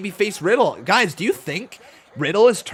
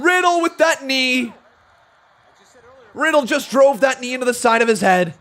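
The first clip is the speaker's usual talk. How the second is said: a higher, louder cry of shock; shocked shouts; 5 semitones higher, 3 dB louder, slower than usual. Real, not acted. Commentator reacting to a knee to the head, shocked shouting. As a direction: gasp and yell at the big knee. Knee strike and near fall.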